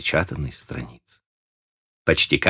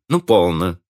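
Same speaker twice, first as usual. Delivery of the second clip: higher, friendly and light; 8 semitones higher than usual. A narrator's reading. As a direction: coax him kindly, it was only a joke. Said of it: "сказала дружеским тоном".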